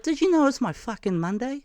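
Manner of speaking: high-pitched mock complaint, Māori lady; teasing OG Māori lady voice. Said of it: "that's how she talks".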